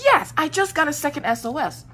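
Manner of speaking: high, emphatic exasperated yes; retold frantic reply. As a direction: re-enact a worked-up confirmation. Panicked reply to sister.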